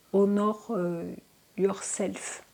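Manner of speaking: "Honor yourself" in soft English with a French accent. Real, not acted. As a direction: read the English line shyly with a French accent. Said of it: "Pardon pour mon accent". (anglais avec un accent français, tout doux)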